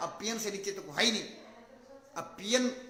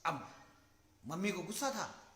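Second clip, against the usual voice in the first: ordinary delivery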